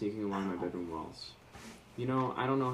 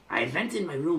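put-on higher, louder botched accent that lands German; mock German-sounding accent; 6 semitones higher, 5 dB louder than usual. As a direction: overdo it with a fake German accent. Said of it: "That's German".

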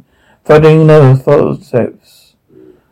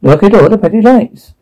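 higher, odd character voice; distorted Poker; 6 semitones higher than usual. A narrator's reading. Poker repeating the line about pretty lights, high distorted voice. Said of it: "Poker di-, distorted".

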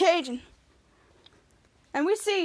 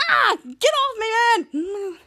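high, loud, drawn-out protest; struggling yell; 6 semitones higher, 4 dB louder, much slower than usual. Retold or imitated. Henry grabbed by Dirk, protesting yell. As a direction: yell to be let go.

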